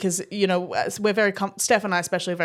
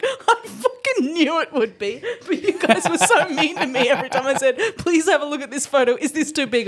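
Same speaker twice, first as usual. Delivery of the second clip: laughing mock complaint; 6 semitones higher, 3 dB louder than usual. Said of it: "he was like".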